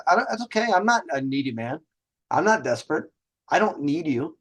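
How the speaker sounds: ordinary delivery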